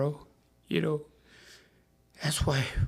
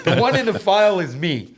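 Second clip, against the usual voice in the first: "The one in the file is me" laughed out higher and louder; laughing; 8 semitones higher, 10 dB louder than usual. Real, not acted. Retelling the courtroom reply, laughing. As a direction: laugh through the line. Repeats quote while laughing.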